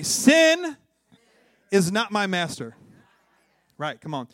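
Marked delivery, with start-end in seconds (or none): 0.0-2.8 loud, high SIN, then a firm finish; emphatic conviction. Shown as a demonstration. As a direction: belt it out so they believe it. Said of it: "some of you guys didn't even believe it".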